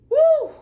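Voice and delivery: loud, high "woo" of amazement; impressed, high-pitched exclamation; high whoop. Real, not acted. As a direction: whoop at a brutal takedown. Reacting to the dressing-down she retold.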